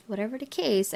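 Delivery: ordinary delivery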